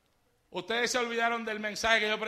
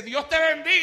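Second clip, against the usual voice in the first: loud blessing greeting; 6 semitones higher, 9 dB louder than usual. Retold or imitated. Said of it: "No me saludes alto".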